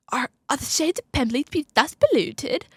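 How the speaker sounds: mock-grand British quote; British accent. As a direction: deliver it as theatrical British English. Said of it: "I just slipped into my British accent".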